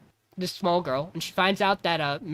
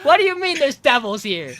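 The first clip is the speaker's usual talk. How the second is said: incredulous question; 7 semitones higher, 7 dB louder than usual. Retold or imitated. Host reacting to Faust's line.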